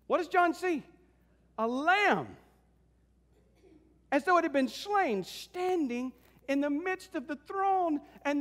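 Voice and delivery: high, emphatic sermon storytelling; fervent preaching. Acted. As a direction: build to the reveal with wonder. Preaches to congregation.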